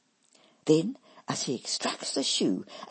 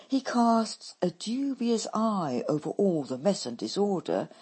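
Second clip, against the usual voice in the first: plain reading voice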